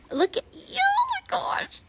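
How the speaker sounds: delighted high-pitched squeal at a foal. Real, not acted. Cooing at the newborn foal.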